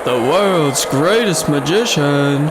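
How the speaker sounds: showman's bold call, drawn out; ringmaster announcer. Acted. Ringmaster welcoming the crowd to the show, grand announcer voice. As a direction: belt it out like a circus ringmaster. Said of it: "announcing the circus show".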